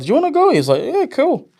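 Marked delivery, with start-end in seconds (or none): 0.0-0.5 high, bright offer; high excited ask. Retold or imitated. Big brother inviting little brother to premiere, high eager ask. 0.8-1.5 put-on much higher kid voice, casual; little brother's voice. Little brother accepting premiere invite, high kid voice.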